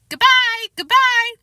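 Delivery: high, loud singsong call; singsong goodbye. A narrator's reading. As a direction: wave them off musically. Fudge keeps calling.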